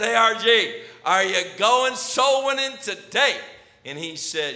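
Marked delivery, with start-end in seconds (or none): 0.0-3.4 put-on loud, high, playful hail to a kid; cheery shout of greeting; calling out cheerfully. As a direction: call across to him with a grin. Calling out to the boy.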